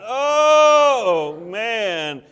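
hammed-up sick moan; loud mock moan. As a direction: moan loud enough to wake her. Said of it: "you have to moan really loud".

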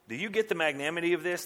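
ordinary delivery

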